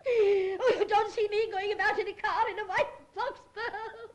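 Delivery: aggrieved crying complaint; weeping, wounded protest; tearful. Acted. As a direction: wail it with bitter self-pity. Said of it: "don't cry".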